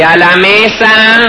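high, slow call of the hostess; calling to the table. Retold or imitated. Hostess calling the guests to the table, high and drawn out. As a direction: sing it out to everyone. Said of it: "la dueña de casa y dice".

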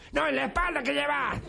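voz aguda e insistente; voz misteriosa del cementerio. Acted. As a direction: exigir una respuesta, en tono alto. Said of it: "siente una voz que le dice". (voz del cementerio interrogando al jorobado, actuada)

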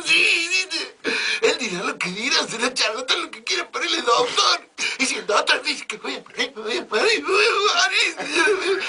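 comic high wailing shout; hammed-up crying shout. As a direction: howl it like a hysterical maid. Maid insists doctor said she'd die.

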